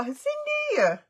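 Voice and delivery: very high, sung-out name; excited greeting. Real, not acted. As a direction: sing the name out in delight. Greeting viewer Cindy.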